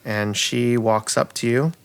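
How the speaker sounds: normal talking voice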